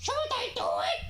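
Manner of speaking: timid little Toad asking. Pretend. Toad asks Mario shyly in a squeaky voice. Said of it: "Can you do Toad's voice?".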